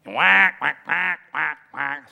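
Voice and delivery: mock quacking, first one loud; duck quack. Preacher imitating a man pretending to be a duck, quacking. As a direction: play the silly duck impostor. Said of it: "sound like the penguin".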